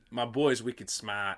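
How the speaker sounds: slow Boston accent, dropped R in smart. Shown as a demonstration. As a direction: try out a drawn-out Boston accent. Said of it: "how to do a Boston accent".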